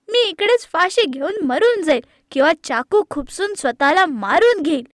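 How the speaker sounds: loud tearful-tantrum yell; shouting. Acted. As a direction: shout it out behind a locked door. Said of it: "ओरडते काव्या".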